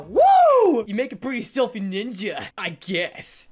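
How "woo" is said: high, loud whoop of joy; excited whoop. Real, not acted. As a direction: cheer out the win. Celebrates a medal.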